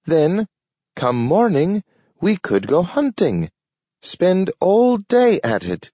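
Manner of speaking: high, talking dog; a dog speaking in a high voice. A narrator's reading. Nathaniel eager to go hunting.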